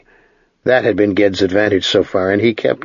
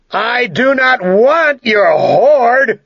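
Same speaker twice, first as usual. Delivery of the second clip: shouting; 4 dB louder, much slower than usual. Acted. Young wizard answers dragon.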